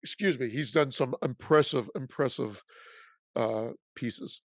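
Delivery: talking through hiccups; words broken by hiccups; hiccuping. Real, not acted. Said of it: "Sorry, I'm hiccuping".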